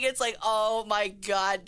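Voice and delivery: normal talking voice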